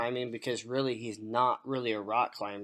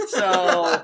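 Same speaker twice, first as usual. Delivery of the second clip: real high burst of laughter; high laughing fit; 4 semitones higher, 9 dB louder than usual. Real, not acted. Laughing at a joke he can't say on the show, real laughter. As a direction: crack up.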